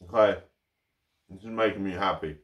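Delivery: talking while chewing; eating a toastie; mouth full. Real, not acted. Seen 3: holds toastie at mouth, bites just before, jaw working throughout.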